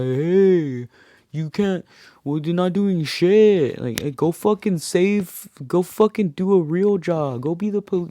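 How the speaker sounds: high, drawn-out mock heckle; trash-talking heckler. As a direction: imitate obnoxious hecklers jeering at police. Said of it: "they're just talking shit".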